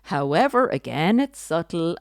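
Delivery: ordinary talk, nothing marked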